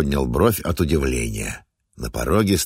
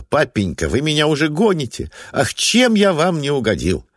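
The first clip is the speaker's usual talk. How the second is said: higher, hammed-up indignation; mock outrage; 9 semitones higher than usual. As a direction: play wounded, theatrically. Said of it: "Олег деланно возмутился".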